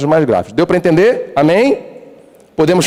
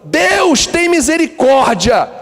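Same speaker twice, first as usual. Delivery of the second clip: high, slow, begging plea to God; pleading sinner's prayer; 13 semitones higher, much slower than usual. Acted. A repentant sinner begging God for mercy, high and slow. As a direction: cry out for mercy, drawn out.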